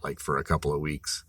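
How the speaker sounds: ordinary talk, nothing marked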